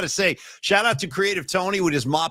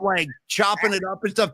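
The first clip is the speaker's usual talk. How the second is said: ordinary talk, nothing marked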